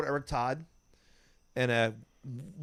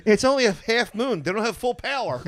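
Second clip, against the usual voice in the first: imitating comic; 8 semitones higher, 5 dB louder than usual. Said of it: "he goes".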